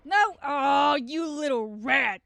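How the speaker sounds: loud high angry yell, slowing on the insult; outraged shout at a game character; furious shout. Real, not acted. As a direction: scream at the little rat.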